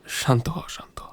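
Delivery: whispered, soothing hush; hushed calming. Acted. Prisoner hushing his distraught friend through bars, whispered. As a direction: quietly settle him down.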